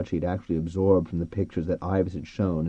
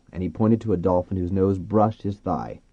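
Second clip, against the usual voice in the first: ordinary delivery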